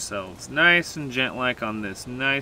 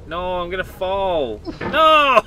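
panicked, high falling scream; screaming while falling; 6 semitones higher, 3 dB louder than usual. Real, not acted. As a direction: shriek as you slip. Losing grip while climbing.

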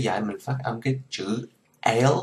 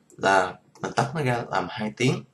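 ordinary talk, nothing marked

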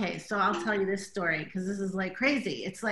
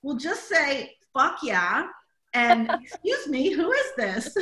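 animated retelling; 5 semitones higher, 4 dB louder than usual. Retold or imitated. Husband's reply and text retold, laughing.

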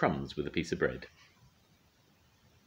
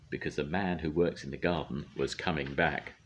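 plain reading voice

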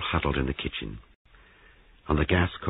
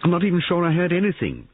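high, loud, fast outburst; overwrought, hurried protest; distressed cry; 6 dB louder, faster than usual. A narrator's reading. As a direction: blurt it out in distress. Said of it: "cried Molly".

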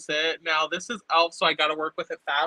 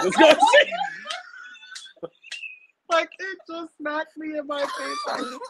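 laughing; slower than usual. Real, not acted. Laughing about smelly burp.

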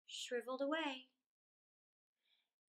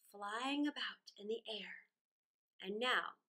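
plain reading voice

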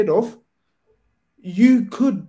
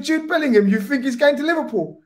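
much higher, scoffing incredulity; ex-player ribbing a fan, mock disbelief; 8 semitones higher than usual. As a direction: laugh off the idea with a high scoff. Dyer mocks the Liverpool fan.

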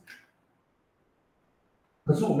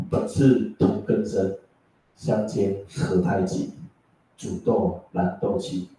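slow, lower recital of verse; poem recitation; 4 semitones lower, slower than usual. Acted. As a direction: recite each line slowly and deliberately.

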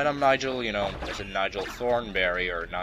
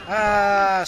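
high, loud "aah" scream; mock scream; 11 semitones higher, 9 dB louder than usual. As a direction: scream like a hammed-up truck victim.

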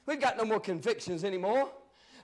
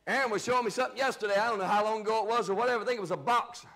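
normal talking voice

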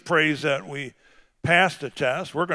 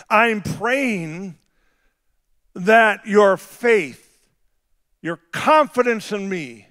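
preaching cadence; 6 semitones higher, 5 dB louder than usual. Acted. Pastor preaching faith to fathers, raised. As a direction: lift it back up to the crowd.

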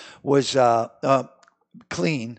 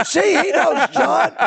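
much higher, louder gleeful crow; triumphant crowing; 13 semitones higher, 5 dB louder, slower than usual. Retold or imitated. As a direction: crow with delight to your friend. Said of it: "I go".

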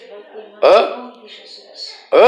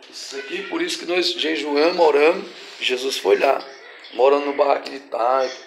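softer, lower voice; subdued aside; 4 semitones lower, 8 dB quieter than usual. Real, not acted. Preacher explaining to congregation why they fast, quietly. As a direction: drop to a quiet, low explanation.

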